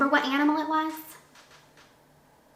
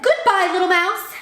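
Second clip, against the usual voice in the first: high, loud storybook fright; acted alarm; 6 semitones higher, 5 dB louder than usual. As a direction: exclaim it as a startled animal. Snake flees from the Gruffalo.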